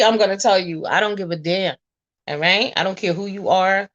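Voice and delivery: ordinary talk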